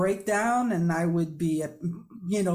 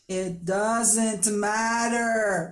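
drawn out, slow; much slower than usual. Retold or imitated. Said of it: "I tell her".

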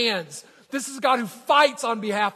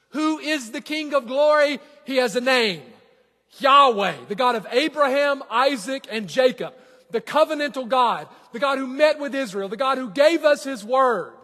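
louder, higher, ringing preacher voice; preaching; 6 semitones higher, 7 dB louder than usual. Acted. Pastor proclaiming God's name to the congregation, fervent.

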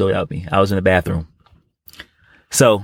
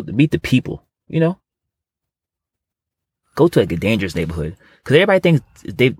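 ordinary delivery

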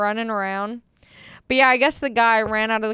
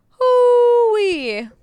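long, high, loud falling hoot; exasperated whoop; 11 semitones higher, 6 dB louder than usual. Retold or imitated. Driver fed up with the good cop act, exasperated whoop. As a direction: let out a drawn-out high "hoo-wee".

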